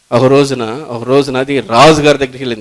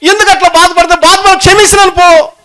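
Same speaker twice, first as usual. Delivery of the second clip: much higher, louder, fervent preaching; climactic preaching; 13 semitones higher, 5 dB louder than usual. Acted. Preacher voicing the exasperated king at full force. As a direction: drive it home at full force.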